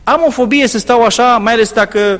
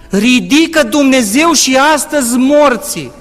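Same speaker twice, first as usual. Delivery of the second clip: higher, louder, slow proclamation; preaching at full force; 5 semitones higher, 4 dB louder than usual. Real, not acted. Preacher telling the church God raises the dead, fervent and loud.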